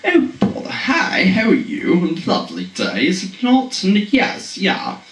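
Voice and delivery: high, hammed-up British accent; playful fake British accent. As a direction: chirp it out like a mock posh Brit. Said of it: "threw on my British accent".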